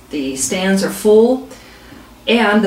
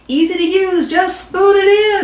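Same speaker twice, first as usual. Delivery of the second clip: higher, slowed chant of the crowd, mock yell; crowd cheering; 9 semitones higher than usual. Crowd stands and yells the slogan, mock cheering. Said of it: "Crowd stands up and yells".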